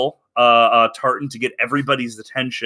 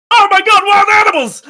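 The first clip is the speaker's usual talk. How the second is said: high, loud hammed-up panic; panicked scream; 7 dB louder than usual. Guard fleeing a wild emu, high panicked yell. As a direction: shriek an alarm as a terrified bystander.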